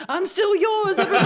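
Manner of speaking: loud, high, laughing proclamation; retold elation. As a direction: announce it to the whole town, thrilled. Proud text to friends, acted out.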